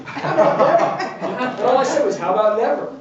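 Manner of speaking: laughing it out, loud and high; can barely get the words out for laughing. Real, not acted. Telling a fifty-year-old client never, laughing loudly.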